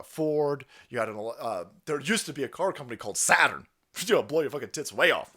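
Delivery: ordinary talk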